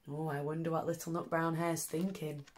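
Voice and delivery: plain reading voice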